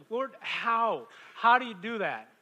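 loud plea. Real, not acted. Crying out to God.

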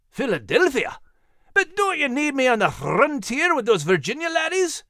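Scottish lilt pleading to go along; Scottish dog, protesting. Acted.

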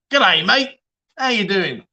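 mock Australian greeting, lifted pitch; Aussie accent. Streamer showing off accents to viewers, mock Aussie accent. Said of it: "Aussie, an Aussie".

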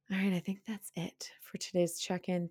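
breathy whisper to close the check-in; whispered aside. Real, not acted. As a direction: drop to a soft whisper, as if sharing a secret. Quiet sign-off aside.

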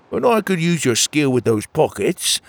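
usual speaking voice

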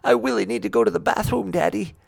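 lightly voiced urgent child; little boy voice. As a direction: play the desperate little boy. Child voiced in car story.

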